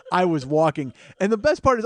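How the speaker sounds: normal talking voice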